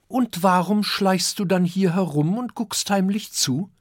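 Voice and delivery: put-on high, curious child voice; little dragon Kokosnuss asking his father, high and curious. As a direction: ask it as an eager small boy.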